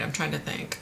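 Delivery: normal talking voice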